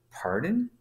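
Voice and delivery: high rising pitch of disbelief; incredulous. Retold or imitated. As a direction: imitate a stunned boss asking. Manager disbelieves he sold out.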